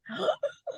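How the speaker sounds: sharp surprised gasp; a quick breathless intake of air. Real, not acted. Fan gasping as the singer walks toward her.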